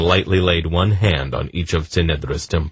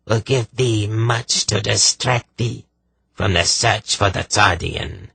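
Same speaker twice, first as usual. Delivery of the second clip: Zandramas; 6 semitones higher than usual. Acted. Sorceress taunts Polgara through her victim, evil and rasping. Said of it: "An evil chuckle escaped".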